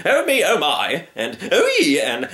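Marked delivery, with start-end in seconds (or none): 0.1-1.1 slow, higher fretting sighs; fussy little man. Acted. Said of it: "he kept saying". 1.5-2.0 high, louder yelp; squeal. Acted. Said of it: "he kept saying".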